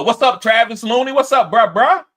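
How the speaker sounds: loud, high, rising hail; calling out. Real, not acted. Host greeting a viewer who just joined, loudly calling out.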